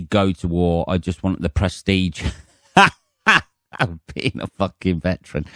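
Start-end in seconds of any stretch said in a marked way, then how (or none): 2.8-5.5 slow words through a laugh. Real, not acted. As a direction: crack up at your own joke while talking. Laughs at his own joke.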